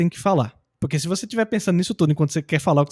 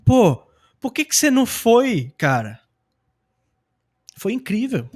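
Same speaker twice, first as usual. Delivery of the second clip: emphatic, higher-pitched friendly reproach; questioning a friend; 5 semitones higher, slower than usual. Acted. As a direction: stretch the question out to a buddy. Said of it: "chegar pra ele e perguntar".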